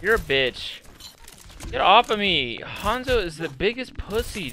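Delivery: ordinary talk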